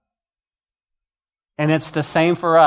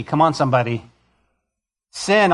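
ordinary talk, nothing marked

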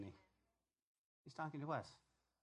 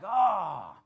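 surprised; 11 dB louder than usual. Retold or imitated. Money gone reaction.

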